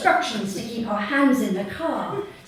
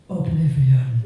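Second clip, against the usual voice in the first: ominous announcement; 7 semitones lower, 6 dB louder than usual. Acted. Naming the ride dramatically.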